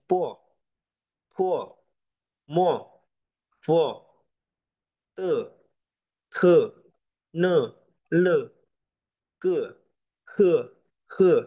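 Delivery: jerk "po", "mo", "te" sharply downward; fourth tone, as if angry. Shown as a demonstration. Teacher models tone four like an angry person, falling tone. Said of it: "disentak kayak nada orang lagi marah".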